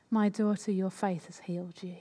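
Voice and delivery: soft, tender words of healing; gentle and tender. Acted. Jesus healing the embarrassed woman, softly and tenderly. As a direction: let it fall gently, like comfort to a frightened woman. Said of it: "he's so gentle, so beautiful, so tender".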